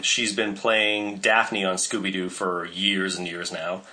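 ordinary talk, nothing marked

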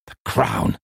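understated angry retort; contained anger on two words. A narrator's reading. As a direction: answer with a touch of anger. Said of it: "I said angrily".

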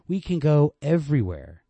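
reach for the dream out loud; high insistent. Acted. Girl pushing back against the man, high and insistent.

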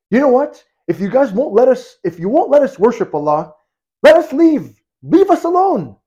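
rising to a high, loud outburst; lashing out. Retold or imitated. Woman leaving Mecca snaps at Umar, rising to a high angry cry. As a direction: fling the last words at him, fed up. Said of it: "lashed out at him".

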